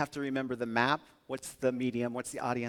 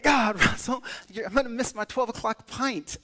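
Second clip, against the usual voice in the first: mock Irish accent; 8 semitones higher, 5 dB louder than usual. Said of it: "I can't do an Irish accent".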